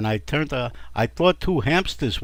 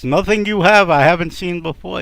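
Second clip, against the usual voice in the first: loud, higher bickering wife; dismissive retort; 5 semitones higher, 8 dB louder than usual. Acted. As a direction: scoff back at the husband. Wife's dismissive retort in acted quarrel.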